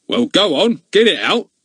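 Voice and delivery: loud, high impatient order; commanding. A narrator's reading. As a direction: command him to speak. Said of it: "commanded Goon".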